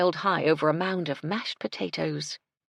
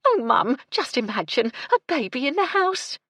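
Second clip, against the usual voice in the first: high, loud burst of delight; delighted housekeeper; 10 semitones higher, 6 dB louder than usual. A narrator's reading. Housekeeper imagines a baby in the house, delighted. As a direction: gush with joy, climbing in pitch. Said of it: "her face creased in smiles".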